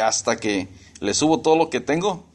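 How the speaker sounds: normal talking voice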